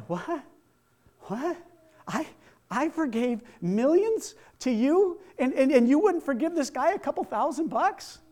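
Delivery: high-pitched, disbelieving king; incredulous outrage. Acted. King confronts the unforgiving servant.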